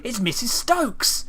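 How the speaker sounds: hammed-up high, loud gasp of surprise; mock great emotion. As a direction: overact the shock for laughs. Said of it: "that was said with great emotion".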